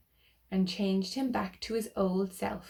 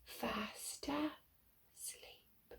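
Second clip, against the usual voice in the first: very slow, unvoiced hush, much softer; sleepy whisper; 2 semitones higher, 15 dB quieter, much slower than usual. Ending the rhyme for children, hushed whisper. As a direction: whisper like not to wake him.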